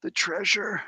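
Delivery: high, feeble captive voice; dying captive. Acted. Captive telling his questioner what is hidden, weak and high. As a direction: answer weakly, as a wounded prisoner.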